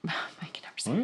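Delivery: unvoiced whisper. Real, not acted. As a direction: whisper it to yourself. Whispered fumble.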